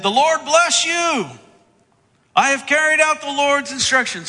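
gushing, high and loud welcome; hammed-up pious hello; over-the-top greeting. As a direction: greet him far too grandly, stretching it out. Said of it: "big, over-the-top greeting".

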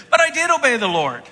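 high, loud whiny denial; mock indignant denial; outright denial. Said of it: "We deny".

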